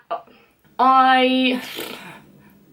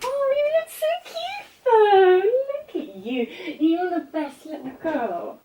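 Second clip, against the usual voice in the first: cooing to the cat; 9 semitones higher than usual. Real, not acted. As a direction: coo at the little cat, much higher. Talking to the cat.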